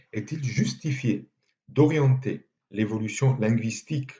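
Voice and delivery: normal talking voice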